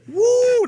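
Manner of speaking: loud high whoop; woo. Real, not acted. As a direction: whoop loud at the nasty smell. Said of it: "that's a nasty".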